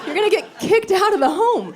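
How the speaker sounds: high laughing exasperated rant. Retold or imitated. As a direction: scold her, half laughing.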